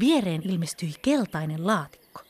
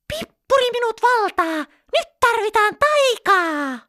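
much higher, squealed magic incantation; shriek; 5 dB louder than usual. A narrator's reading. Pippa shrieking a magic spell in her temper, squealing. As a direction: squeal it out as the anger boils over. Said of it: "hän kiljahti".

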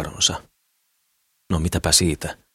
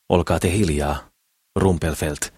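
usual speaking voice

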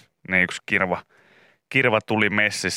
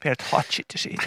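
whispered aside; 4 semitones higher, 6 dB quieter than usual. Acted. Whispered punchline.

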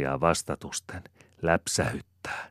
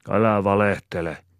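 higher, louder angry roar; angry bark; 5 semitones higher, 6 dB louder than usual. A narrator's reading. Malakias roars at the mocking farmhand, angry. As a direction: snap at him to stop lying. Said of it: "ärjäisee Malakias".

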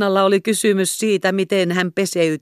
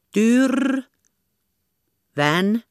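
"Tyr" high, then "vän" after a gap; halting; 6 semitones higher than usual. A narrator's reading. Kerttu forcing out her real name through hiccups. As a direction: stammer the name out in two bits. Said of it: "hän sai vaivoin äänetyksi".

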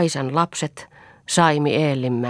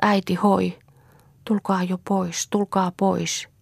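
crying and calling; 5 semitones higher than usual. Acted. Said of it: "katkerasti itkien huutelivat".

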